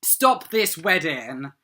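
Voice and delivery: half-acted dramatic shout; mock heroic shout. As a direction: burst in like the Doctor stopping a wedding. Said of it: "he enters saying".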